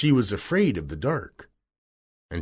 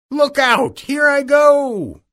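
very high, loud, drawn-out shriek; witch's cry; 7 dB louder, slower than usual. A narrator's reading. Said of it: "cried the witch".

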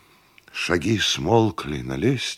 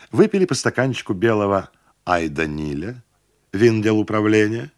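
usual speaking voice

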